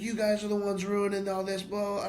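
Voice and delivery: high-pitched imitation of a complainer; dismissive retold rant; mock ranting. Imitates the ranting scooter rider.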